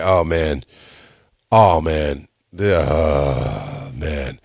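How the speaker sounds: low, soft, drawn-out moaning; queasy drunk groan. Retold or imitated. Drunk podcaster hanging out after the show, queasy groaning.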